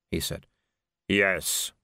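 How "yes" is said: low, solemn single word; Death. A narrator's reading. Answering the dead lord, low and solemn. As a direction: confirm it deep and final. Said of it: "said Death".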